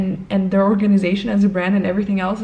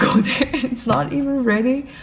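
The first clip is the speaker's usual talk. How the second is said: ordinary talk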